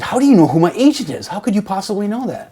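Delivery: imitating an annoyed author grilling a stranger; irritated demand. Author demands how the narrator knows his agent, irritated and loud. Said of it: "He looked irritated".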